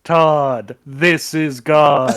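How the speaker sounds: loud, slow, godly boom. Retold or imitated. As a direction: boom it like God from the heavens. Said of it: "Obligatory Simpsons reference".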